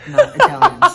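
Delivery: laughing. Real, not acted. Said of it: "laughing at accents".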